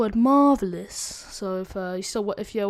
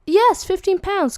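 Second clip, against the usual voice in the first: high, drawn-out excited cheer; retold delight, loud and high; gleeful; 10 semitones higher, 9 dB louder, slower than usual. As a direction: whoop it like an easy win. Retells own gleeful reaction to money.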